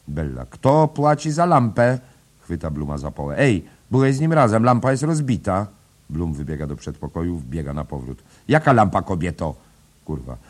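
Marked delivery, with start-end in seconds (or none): 0.4-5.7 loud, much higher scolding; shrill money demand; angry demand. A narrator's reading. Bella demands payment angrily. 8.5-9.5 indignant retort. A narrator's reading. Bloom protests.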